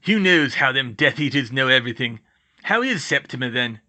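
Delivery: loud rustic big-man grumble; gruff gamekeeper's complaint; Hagrid, grumbling. Acted. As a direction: grumble gruffly, then ask after a friend. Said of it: "Hagrid grumbled".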